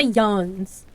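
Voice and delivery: yuns drawn out slowly; slow local yuns; Appalachian dialect word. Shown as a demonstration. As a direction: say yuns as one slow syllable. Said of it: "we say yuns".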